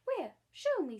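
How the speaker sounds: high, loud, breathless demand; breathless eager cry. Acted. Lady begging boy to show her lost dog, loud breathless. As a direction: burst out high and loud, desperate to see. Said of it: "said Missus Lark breathlessly".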